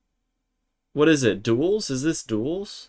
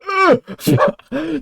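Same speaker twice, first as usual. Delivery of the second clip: shrieking burst of laughter, much higher; high delighted laugh; 12 semitones higher, 6 dB louder than usual. Real, not acted. Streamer reacting to a huge score, high delighted laugh. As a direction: laugh out loud in disbelief at the score. Big hit in the duel.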